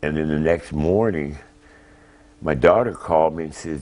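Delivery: ordinary talk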